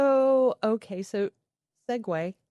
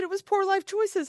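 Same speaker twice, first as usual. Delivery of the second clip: much higher, sheepish owning up; high confession; 13 semitones higher, 3 dB louder than usual. Retold or imitated. Admitting the real cause to the family, high and light. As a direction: toss it off up high. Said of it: "I said".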